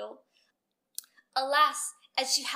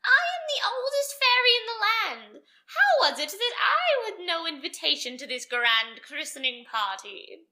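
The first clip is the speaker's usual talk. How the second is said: put-on much higher, loud screeching old fairy; wicked fairy screeching her outrage at the king and queen; shrill screech; 6 semitones higher, 11 dB louder than usual. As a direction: screech it like an angry old witch. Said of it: "her voice was harsh and shrill ... she screeched".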